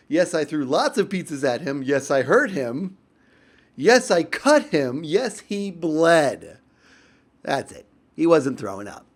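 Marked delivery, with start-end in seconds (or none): 1.8-6.6 slow, loud, deliberate admissions; mock-solemn confession, drawn out. Real, not acted. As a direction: lay out each yes heavily. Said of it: "I'm serious, telling the truth now".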